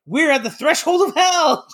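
high, incredulous Clark rant; exasperated dad at his family's breaking point; indignant Clark outburst. Retold or imitated. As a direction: pitch it way up in disbelief. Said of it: "He's like".

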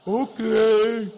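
hammed-up dopey okay; dumb obedient okay. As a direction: play the clueless husband. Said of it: "Abraham goes".

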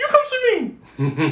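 very high, slow falsetto coaxing; high-pitched dog call. Shown as a demonstration. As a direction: coax the dog over in a tiny high voice. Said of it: "you get that high pitch".

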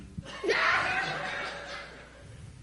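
high, airless giggle; light unvoiced laugh; breathy giggle. Wife laughing in bed.

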